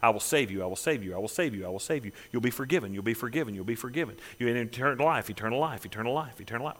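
gentle repeated promise. Acted. Jesus promising salvation to all, hushed and low. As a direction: murmur it like a tender promise, dying away. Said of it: "he was a broken record".

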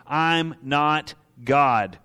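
very slow, each word weighted; slow emphatic. Acted. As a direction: drop it slowly and heavily. Repeated sermon punch line.

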